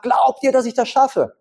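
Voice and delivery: a showman challenging the crowd; calling tightrope walker. Acted. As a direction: daredevil teasing the cheering crowd, calling out.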